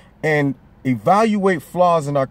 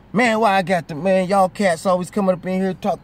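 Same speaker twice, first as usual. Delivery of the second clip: put-on higher, mouthy street-kid retort; mock defiant youth; 5 semitones higher than usual. Young man mouthing off at the officer, mock defiant. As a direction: talk back like a cocky kid to a cop.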